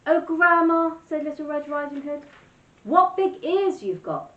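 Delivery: put-on high, loud, wide-eyed little girl. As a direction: gasp it out in innocent wonder.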